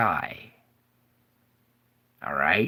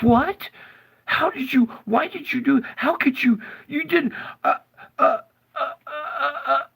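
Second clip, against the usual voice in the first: very high, stammering panic; Satan caught, babbling in shock; faster than usual. Retold or imitated. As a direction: splutter like a cornered cartoon villain. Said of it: "in shock".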